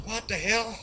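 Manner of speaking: high-pitched, incredulous bear; baffled outburst. Acted. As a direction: pitch jumps way up in disbelief. Bear reacts to baffling accusation.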